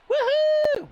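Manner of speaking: high, loud whoop of joy; cheer. Real, not acted. Commentator cheering the sunshine at Brands Hatch.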